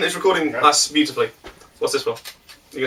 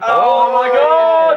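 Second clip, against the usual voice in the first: high, slow shocked cry; drawn-out oh my God; 8 semitones higher, 4 dB louder, much slower than usual. Real, not acted. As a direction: stretch it out in shock. Shocked at friend's gag.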